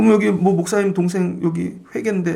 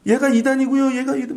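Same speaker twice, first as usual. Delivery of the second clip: tattling accuser; 7 semitones higher than usual. Retold or imitated. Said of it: "막 이러는데".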